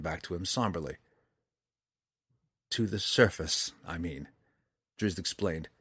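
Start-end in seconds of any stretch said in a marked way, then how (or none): none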